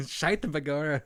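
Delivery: laughing Irish brogue, high and loud; Irish beat cop. Retold or imitated. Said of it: "He's an Irish beat cop".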